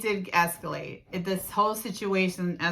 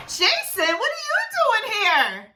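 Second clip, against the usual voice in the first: higher, hammed-up fake shock; badly faked surprise; 13 semitones higher, 5 dB louder than usual. Catching the boyfriend at her friend's house, badly faked surprise. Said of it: "the acting was so bad".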